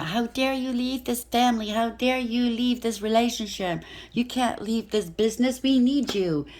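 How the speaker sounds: high, outraged guilt-trip; a family member shaming you for leaving, pitched high; indignant family. Retold or imitated.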